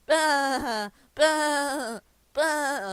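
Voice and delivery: very loud, high forced laugh bursts; hammed-up, blaring bah-ha cackle; hysterical laughter. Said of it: "starts to laugh hysterically".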